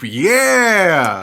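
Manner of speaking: long, loud high whoop; high, stretched-out hurrah; group cheer. Acted. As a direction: draw out a crowd's cheer. Voicing a big group cheer.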